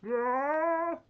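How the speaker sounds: loud echoing whoa; cave echo. Real, not acted. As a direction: call out to hear the echo. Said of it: "Oh, I can hear echo".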